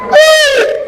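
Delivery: another high frightened shriek; mock scream. Shown as a demonstration. Preacher repeating the scared scream. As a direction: squeal as if startled. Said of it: "Grita, hein?".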